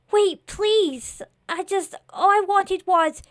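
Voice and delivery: high, faltering plea; desperate pleading. Acted. Scarecrow begs the boy to listen, desperate. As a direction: beg to be heard, voice pitched high.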